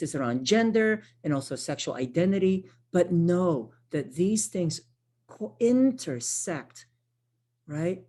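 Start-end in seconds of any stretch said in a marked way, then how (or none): none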